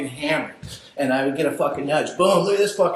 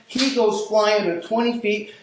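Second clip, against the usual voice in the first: ordinary talk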